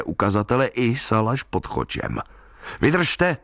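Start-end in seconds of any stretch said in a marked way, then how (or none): 2.8-3.4 high-pitched call into rain. A narrator's reading. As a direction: call out encouragingly, pitch lifted. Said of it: "volal jsem do dešťové clony".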